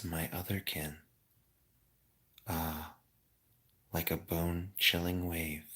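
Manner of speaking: soft, slow gloomy calm. Pretend. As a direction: read it soothingly, hushed. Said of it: "I'm gonna read the chat in a really calm voice".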